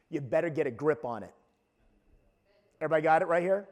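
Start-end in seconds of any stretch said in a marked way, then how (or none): 0.1-1.3 low, quiet, quick warning; family admonition. Retold or imitated. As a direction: drop the voice for a firm word. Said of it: "here's a word that's used in our family".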